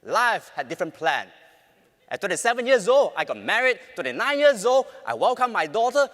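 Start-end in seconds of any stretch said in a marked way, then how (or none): 2.1-6.1 loud, quick milestone countdown; loud projection. Real, not acted. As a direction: build the list with energy. Listing life milestones loudly.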